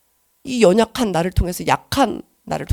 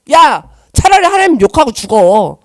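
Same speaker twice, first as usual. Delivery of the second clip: louder, slow, taunting Satan; mocking tempter voice; 4 semitones higher, 6 dB louder than usual. Satan taunting her in the spiritual battle, slow loud mock. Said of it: "사단이요, 저한테 말해요".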